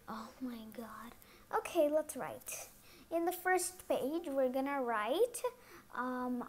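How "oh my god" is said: exasperated whisper. Pretend.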